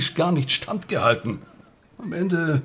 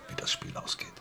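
hushed whisper; 13 dB quieter than usual. Acted. Confession under interrogation.

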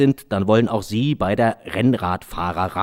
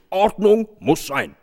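higher, slow officious police voice; stern police; 9 semitones higher, slower than usual. Acted. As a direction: lay down the rules. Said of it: "riefen die Polizisten". (höher, langsam, amtliche Polizeistimme)

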